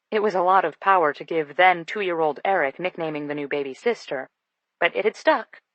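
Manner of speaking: usual speaking voice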